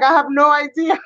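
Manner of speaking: high-pitched baffled self-quote; baffled protest. Retold or imitated. Acting out her baffled reply.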